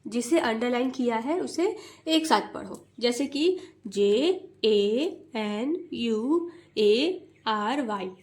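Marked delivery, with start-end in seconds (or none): 3.9-8.1 slow, even letter-by-letter spelling; each letter spaced out slowly; wrong way, slow. Shown as a demonstration. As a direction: drag out every letter separately. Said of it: "ऐसे नहीं पढ़ना है".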